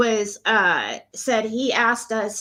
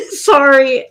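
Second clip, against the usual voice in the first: laughing; 4 semitones higher, 9 dB louder than usual. Real, not acted. Laughs during the call.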